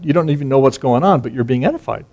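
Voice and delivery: ordinary delivery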